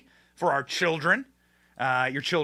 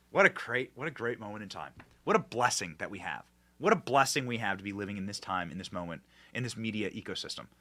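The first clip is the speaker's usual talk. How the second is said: soft, lower, savoring each phrase; hushed awe; 7 semitones lower, 9 dB quieter than usual. Real, not acted. Host marveling at the media landscape, softly awed. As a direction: murmur it like a grateful blessing.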